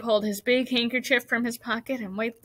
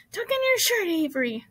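high-pitched scolding call; crying out; 8 semitones higher, 3 dB louder than usual. A narrator's reading. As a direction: call out sharply to a messy boy. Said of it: "cried Missus Zuckerman".

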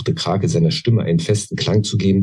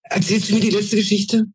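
much higher, begging little goblin; almost pleading. A narrator's reading. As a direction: plead for the last story. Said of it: "fragte er fast flehentlich". (fast flehentlich, sehr hoch)

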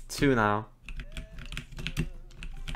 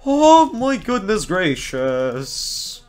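exaggerated exclaim; 6 semitones higher, 4 dB louder, much slower than usual. Real, not acted.

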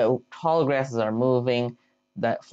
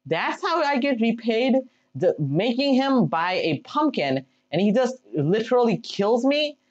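higher, indignant complaint; mock outrage; 7 semitones higher than usual. Real, not acted. Gamer killed by his own iron golem, mock outrage. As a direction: play up the betrayal.

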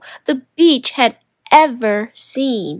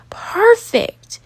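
much higher, delighted little word; pleased boy; 11 semitones higher than usual. Acted. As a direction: savour finding the spot. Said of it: "Gideon said".

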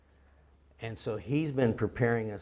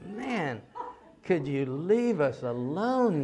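high, loud, drawn-out exasperation; exasperated plea; 7 semitones higher, 10 dB louder, much slower than usual. Retold or imitated. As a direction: stretch it out in mock exasperation. Fed up with persistent street solicitor.

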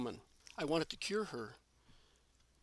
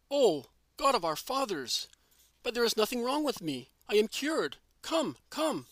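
put-on high, louder, amazed old woman's outcry; crying out; 6 semitones higher, 4 dB louder than usual. A narrator's reading. Old woman suddenly cured of her fever, crying out high. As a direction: cry out in joy at being cured. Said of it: "Then the old woman cries out".

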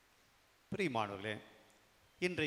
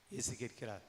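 ordinary talk, nothing marked

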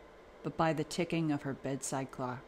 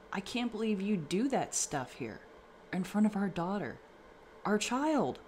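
upset mother; 6 semitones higher than usual. A narrator's reading. Mother scolding father before their daughter.

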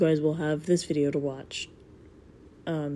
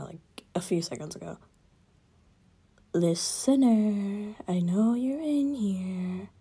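roleplay voice; 3 semitones higher than usual. Acted. Roleplay video addressing the listener.

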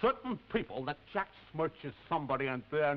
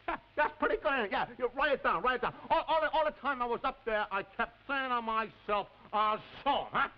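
high-pitched, gloating boast; gloating excitement; 8 semitones higher than usual. Acted. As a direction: crow about getting even, voice jumping up. Boasting to someone writing it down.

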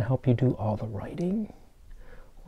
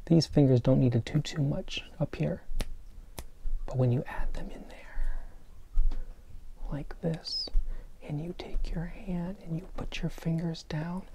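whispering; 4 semitones higher, 7 dB quieter than usual. Shown as a demonstration. Whispering snapping instructions to kids.

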